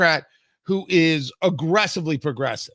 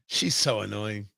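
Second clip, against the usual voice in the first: ordinary talk, nothing marked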